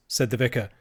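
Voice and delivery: plain reading voice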